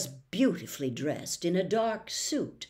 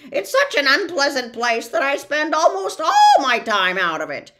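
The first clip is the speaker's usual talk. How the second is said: loud, high disdainful bird; 6 semitones higher, 10 dB louder than usual. Acted. The bird sneers at Context, loud and high. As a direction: scorn your home, praise the grime.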